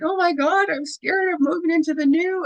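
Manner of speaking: high-pitched mock panic; acted fear. Voicing a viewer scared of moving into the new, mock-frightened. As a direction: play someone fretting, pitch jumping well up. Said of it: "we can easily go into fear".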